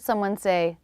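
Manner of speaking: ordinary delivery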